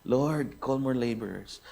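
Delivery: soft, low murmured prayer; quiet prayer. Retold or imitated. Husband prays softly in the car while wife drives. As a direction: pray under your breath. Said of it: "ako po yung nagpipray".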